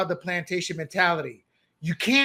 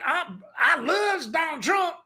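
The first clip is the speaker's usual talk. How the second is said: voicing a resident; 8 semitones higher, slower than usual. DC residents voiced, retold.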